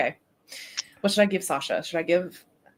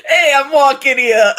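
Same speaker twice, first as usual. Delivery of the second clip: loud, higher hammed-up Brooklyn New York accent through a laugh; playful New York cabbie bluster, laughing as she says it; 8 semitones higher, 16 dB louder than usual. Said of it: "It's giving New York, Brooklyn".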